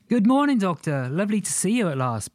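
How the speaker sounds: high, sweet cooing greeting. Acted. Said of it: "Patricia cooed".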